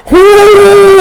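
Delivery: high, loud mock horse whinny. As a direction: throw out a big high horse cry. Horse rearing in the story.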